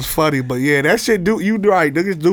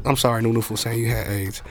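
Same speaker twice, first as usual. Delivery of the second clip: muted apology; 9 semitones lower, 9 dB quieter than usual. Real, not acted. Host apologizes to friend after a careless joke, muted and low. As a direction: lower your voice and apologize.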